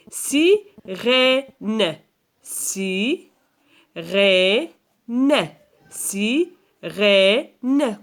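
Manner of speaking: si... rè... ne, each part held; syllable-by-syllable. Shown as a demonstration. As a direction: split sirène into three separate beats. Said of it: "Combien de syllabes".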